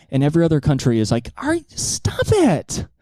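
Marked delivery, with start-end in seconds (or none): 1.4-2.9 very high, slow pleading; high drawn-out whine; whiny scolding. Acted. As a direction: drag it out like a tired parent. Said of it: "how Ayatollah Khomeini should speak".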